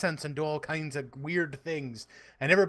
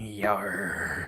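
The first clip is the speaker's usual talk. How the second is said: 1 pirate yar; 8 semitones lower, 4 dB quieter than usual. Pretend. Pirate bit.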